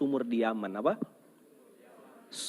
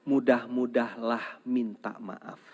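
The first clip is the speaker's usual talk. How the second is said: slow lead phrase; 3 semitones lower, slower than usual. Shown as a demonstration. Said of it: "Coba diulang".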